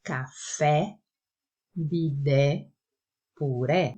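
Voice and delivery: "Café, bidé, puré" with open final E, like é in Portuguese; open E examples. Shown as a demonstration. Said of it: "Vejamos aqui alguns exemplos". (E aberto em "Café, bidé, puré")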